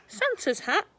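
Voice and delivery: usual speaking voice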